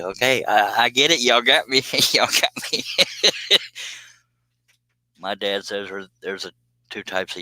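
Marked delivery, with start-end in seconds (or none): 0.0-2.8 higher voice breaking into real laughter; laughing concession. Real, not acted. Host admits the chat got him, laughing. 2.9-4.0 high-pitched burst of laughter; real laugh. Real, not acted. Host cracks up at the chat's teasing, laughing.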